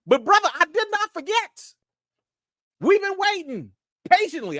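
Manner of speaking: high-pitched playful outrage; hammed-up accusing whine; mock indignation. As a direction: call him out in a high, incredulous voice. Mock scolding of a viewer.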